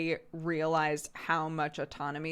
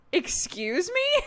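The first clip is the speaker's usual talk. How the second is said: slow, high, drawn-out excuse me; mock indignant; 12 semitones higher, slower than usual. Mom retelling her reply to a demanding toddler, mock indignant. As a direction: stretch it in mock outrage. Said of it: "I'm literally doing it".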